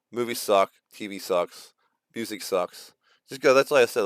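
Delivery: ordinary talk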